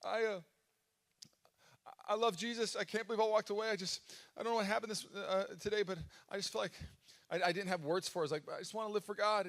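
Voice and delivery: high, tearful, breaking voice; teary confession. Retold or imitated. Pastor retelling his tearful call to buddy Drew, high teary voice. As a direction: choke it out like you're still crying. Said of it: "then I call my buddy Drew".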